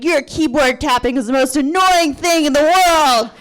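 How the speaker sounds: put-on high, loud, exasperated drunken tirade; drunken rant. Drunk patient to tapping technician, loud furious rant. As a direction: rail drunkenly, swelling to the last word. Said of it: "I sound drunk and I feel drunk".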